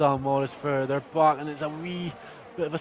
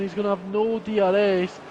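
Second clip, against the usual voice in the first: usual speaking voice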